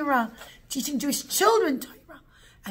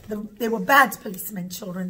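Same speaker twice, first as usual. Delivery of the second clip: usual speaking voice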